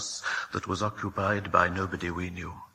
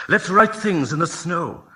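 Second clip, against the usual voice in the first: a touch higher and louder, keen; eager suggestion; 7 semitones higher, 5 dB louder than usual. Acted. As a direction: pitch the idea with eager glee. Children plotting a snow prank.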